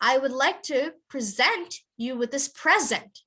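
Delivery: "present" stressed as preSENT first, "present" stressed as PREsent at the end, said slowly; slow model sentence; present verb vs noun. Shown as a demonstration. Teacher modelling present stress to students, slowly. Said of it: "I would say this as".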